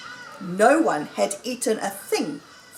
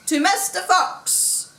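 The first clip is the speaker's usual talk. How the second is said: high, drawn-out cheer of a toast; shouted toast; 10 semitones higher than usual. A narrator's reading. Diners answering Badger's toast to Mister Fox, shouting. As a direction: raise a high cry to the hero. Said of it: "they all shouted".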